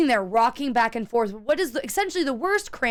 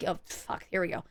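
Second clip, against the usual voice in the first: dreaded whisper; 6 semitones lower, 11 dB quieter than usual. Retold or imitated. About to throw up.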